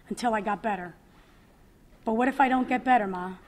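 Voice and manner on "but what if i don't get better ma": fast, louder, anguished; panicked plea to her mother; desperate question. Retold or imitated. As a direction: blurt it out in despair. Pleading with mother while distraught.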